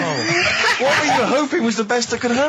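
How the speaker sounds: high, laughing mockery; teasing through laughter; laughing at a friend's story. Real, not acted. Mocking Carl while laughing.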